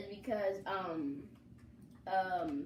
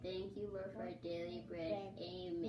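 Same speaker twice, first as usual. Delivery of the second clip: saying grace; 2 semitones lower, 6 dB quieter than usual. Real, not acted. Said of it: "let's hear your grace".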